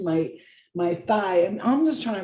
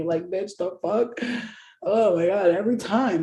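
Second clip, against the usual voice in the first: ordinary talk, nothing marked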